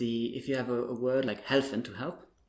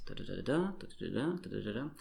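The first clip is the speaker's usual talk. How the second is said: "da" run together smoothly, the last one stressed and rising; soft, smooth "da-da-da-DA", leaning on each group's end; French final stress; 6 dB quieter, faster than usual. Shown as a demonstration. Teacher contrasting French with German, smooth final-stressed groups. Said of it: "final stress".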